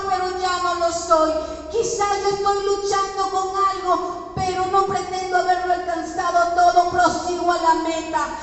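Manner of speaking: loud, insistent prayer of surrender; impassioned prayer. Real, not acted. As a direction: cry out to God at full force. Leads prayer at full force. (oración a toda voz, con fervor)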